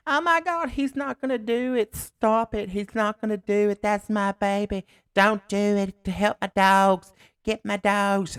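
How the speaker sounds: very high, loud mock screaming; imitated hysterical woman. Woman in the back seat begging for him, mock hysterics. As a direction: shriek it like a panicking woman, words stretched. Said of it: "in the back seat of my truck, I hear".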